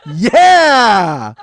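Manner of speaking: high, very loud cheer; elated shout. Retold or imitated. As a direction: roar it out long and triumphant. Reliving his elation at the line.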